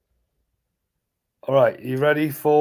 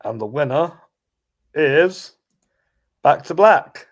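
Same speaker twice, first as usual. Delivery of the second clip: pause, then announce the winner louder; suspenseful awards presenter; 5 dB louder than usual. Acted.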